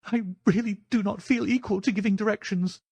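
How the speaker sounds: high-pitched, frightened plea; timid little man imploring; pleading. Acted. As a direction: beg in a high, helpless voice. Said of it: "pleaded Thaddeus Sholto".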